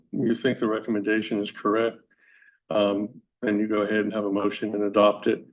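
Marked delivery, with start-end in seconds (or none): none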